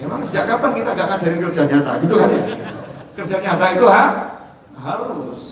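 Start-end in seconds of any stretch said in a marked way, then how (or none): none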